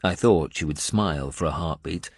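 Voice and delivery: plain reading voice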